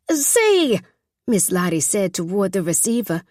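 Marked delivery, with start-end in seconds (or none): 0.1-0.8 a louder, much higher told-you-so. Acted. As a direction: call it out so the phone catches it. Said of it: "loudly enough for Papa to hear".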